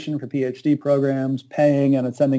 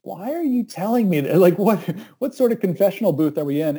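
higher, puzzled disbelief; incredulous; 5 semitones higher than usual. Retold or imitated. To the pastor, pitched-up puzzled disbelief. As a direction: ask it like a baffled confessor.

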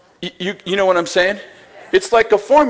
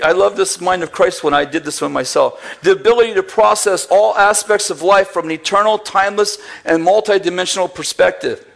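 ordinary talk, nothing marked

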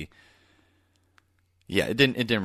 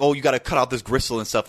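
higher, louder fussing waiter voice; quick condescending waiter fussing; fussy waiter; 5 semitones higher, 4 dB louder, faster than usual. Retold or imitated. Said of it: "it's like".

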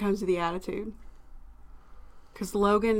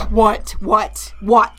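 irritated snap; 8 dB louder, much slower than usual. Retold or imitated. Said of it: "he's like".

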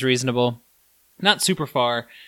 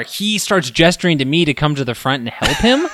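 ordinary talk

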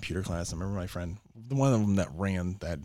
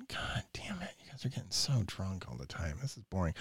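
whispered then soft grumble; muttered under breath, bored; 3 semitones lower, 9 dB quieter than usual. Retold or imitated. Watching drunk friends, soft bored mutter. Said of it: "you start looking around going".

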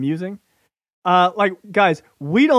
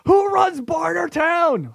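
high, slow shouted question; mock yell; 11 semitones higher, slower than usual. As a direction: yell it like a madman. Screaming at other podcasters.

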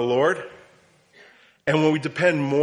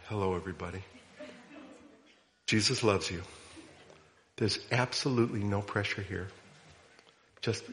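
much softer, lower, soothing pastor voice; gentle pastor voice; 5 semitones lower, 10 dB quieter than usual. Shown as a demonstration. Preacher mocking his own gentle pastoral voice, soft and hushed. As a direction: hush it down like a gentle pastor easing the room. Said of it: "The teaching voice is all of a sudden".

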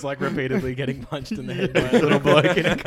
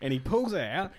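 ordinary talk, nothing marked

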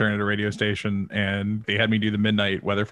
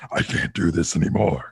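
low, scratchy cartoon-villain voice; stuck villain voice; 6 semitones lower than usual. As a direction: complain in a deep throat-scraping growl. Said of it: "My voice is gonna stick like that".

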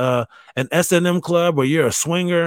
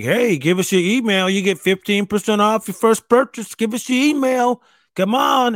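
a touch of an eager salesman voice, higher; pushy website banner; 6 semitones higher than usual. Retold or imitated. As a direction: pester like a pop-up ad. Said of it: "it's just like".

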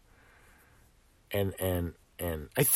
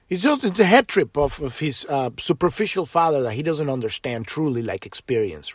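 ordinary talk

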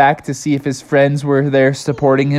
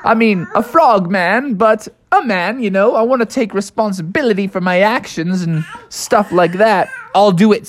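high frog voice firming up; high squeaky resolved frog; determined frog; 11 semitones higher than usual. Acted. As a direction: talk yourself into courage. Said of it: "Froggy's like".

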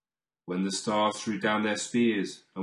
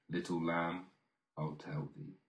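much softer, fading almost to a whisper; soft echo; 5 semitones lower, 11 dB quieter than usual. Acted. Reader echoes the promise to the lamb, hushed and soft. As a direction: let the refrain die away gently.

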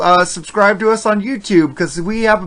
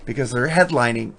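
normal talking voice

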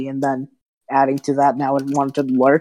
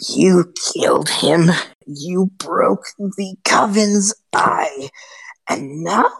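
slow unvoiced hissing hag whisper; 4 semitones higher, slower than usual. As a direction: creep the words out like a cornered witch.

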